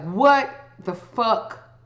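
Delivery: normal talking voice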